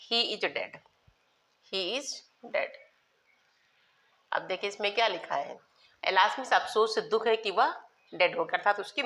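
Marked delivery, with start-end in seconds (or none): 1.7-2.7 very slow, each word set apart; slow dictation. Shown as a demonstration. Teacher repeating an example for pupils, slow dictation. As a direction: stretch IS and pause before DEAD.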